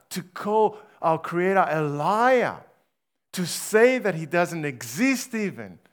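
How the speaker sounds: full-force sermon voice; fiery preaching. Acted. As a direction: thunder the accusation at the congregation. Preacher thundering at congregation.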